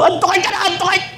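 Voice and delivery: high, breathy-soft gleeful urging; giddy shopper. Acted. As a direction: egg yourself on to buy. Mimicking shopaholic wife.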